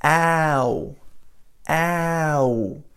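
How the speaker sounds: "ow" drawn from an a sound into oo; ow glide demo. Shown as a demonstration. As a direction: slide the "ow" from ah to oo. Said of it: "So you go".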